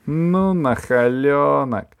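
slow, drawn-out gleeful tut; gleeful scolding. A narrator's reading. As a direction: relish the cheeky word. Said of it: "Тётя Валя совсем развеселилась".

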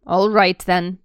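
Dudley settling for the deal; grudging child. Acted. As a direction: accept it with a huff. Dudley accepting.

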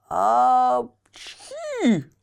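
hammed-up thunderous sneeze; noisy sneeze. A narrator's reading. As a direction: sneeze with a huge blast. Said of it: "чихнул Карабас-Барабас с таким шумом".

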